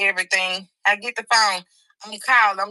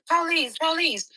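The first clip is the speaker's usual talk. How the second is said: slow higher call-out; police announcing themselves; mild retold call of the police; officers calling; 7 semitones higher than usual.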